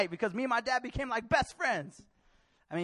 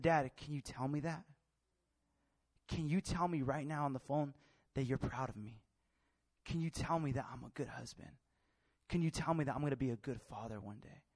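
low, soft, vulnerable request; hushed, heartfelt asking; earnest plea; 6 semitones lower, 7 dB quieter than usual. Retold or imitated. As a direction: ask a father quietly and sincerely. Son asks father for affirmation.

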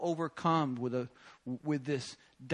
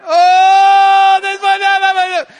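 very high, loud wailing cry; hammed-up sobbing shout; overcome weeping cry; 20 dB louder, slower than usual. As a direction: wail at every cross you see. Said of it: "start weeping and crying".

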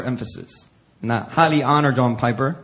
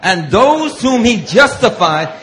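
slow, loud, higher cadence building; preaching scripture; 7 semitones higher, 7 dB louder, slower than usual. Acted. Preacher leading the congregation in verse thirty, slow and loud. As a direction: lead the church through each line.

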